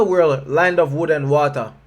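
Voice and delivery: ordinary talk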